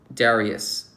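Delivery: usual speaking voice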